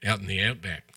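a light put-on Australian accent; playful hint of Australian; mock Australian. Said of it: "That's pretty good, [name]".

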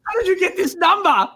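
excited. Acted. Reggie excited questioning.

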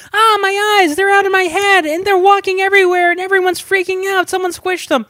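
put-on high, panicked screaming kid. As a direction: scream about your lost eyes. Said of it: "what if he actually screamed that".

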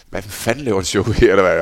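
indignant outburst. Retold or imitated. Goalkeeper retelling his anger at the yelling coach, loud indignant outburst. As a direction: throw it back at the shouting coach, fed up.

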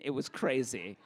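ordinary delivery

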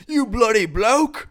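laughing, high, loud put-on British yell; hammed-up protester bellowing at the king, in a put-on British accent; mock British shout. Hosts mimic a movie protester, laughing British shout. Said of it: "he's shouting that".

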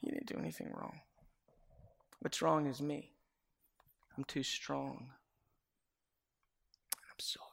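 soft, almost whispered, long pauses between phrases; broken apology. Retold or imitated. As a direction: confess it barely above a breath. Retold tearful apology to wife.

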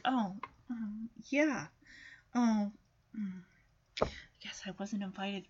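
very quiet, low, halting disappointment; crushed. Acted. Voicing crushed Charlie Brown.

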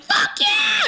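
very high, gleeful yell; mock screamed cheer; jubilant shout. Friends cheering as Douglas announces he is leaving, jubilant shout.

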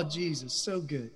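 ordinary delivery

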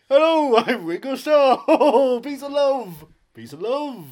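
high-pitched, loud musician impression. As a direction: put on a hammed-up high voice, laughing between phrases. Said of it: "do my [name] voice".

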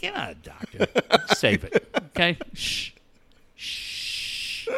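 high, soft brush-off, then shushes; quiet mock put-down ending in long shushes; mock dismissive shushing. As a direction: playfully hush someone who knows better. Mock-dismissing a doctor, shushing her.

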